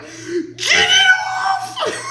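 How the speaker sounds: high, slow, crying shout; panicked crying shout. Retold or imitated. Waking her husband in the tent, high sobbing shout. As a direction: cry out in panic to get it off. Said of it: "I was like".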